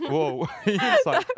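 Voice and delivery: high, slow, drawn-out wow with a laugh; a delighted, laughing wow at the blunt answer; amused surprise. Real, not acted. Host laughs in surprise at guest's one-word answer, drawn-out amused wow.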